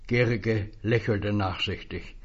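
ordinary delivery, nothing marked

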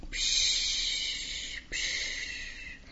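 gehauchtes Meeresrauschen nachahmen; geflüstertes Wellenrauschen. Acted. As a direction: rausche wie Wellen, tonlos. Wellengeräusch nachgeahmt. (nachgeahmtes Wellenrauschen, gehaucht)

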